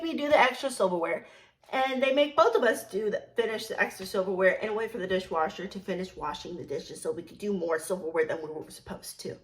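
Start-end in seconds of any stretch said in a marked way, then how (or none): none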